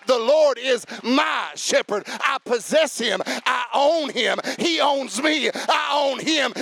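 loud, high, ringing preacher's shout; full-force preaching. Acted. Pastor preaching loud to the church about God's protection. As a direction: preach it out big to the congregation.

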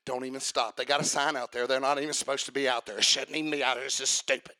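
low, soft grumble in imitation; mock annoyed grumbling. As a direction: grumble to yourself like an irritated driver. Mimics drivers annoyed at panhandlers.

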